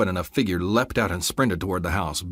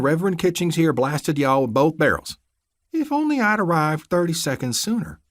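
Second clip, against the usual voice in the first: scornful deputy; 8 semitones higher than usual. Acted. Crooked deputy sneering his cover story at the captives.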